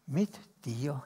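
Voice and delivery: usual speaking voice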